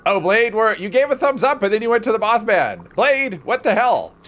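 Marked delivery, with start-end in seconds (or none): 2.9-4.1 slow, exaggerated disbelief; mock outrage. Real, not acted. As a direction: drag it out, playfully scandalized. Teasing teammate who skipped raid.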